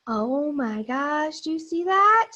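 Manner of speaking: put-on wide-eyed wonder for the kids; hammed-up surprise at a hidden friend; mock surprise. Teacher spots zoo friend in book.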